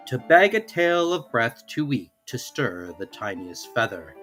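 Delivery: ordinary delivery, nothing marked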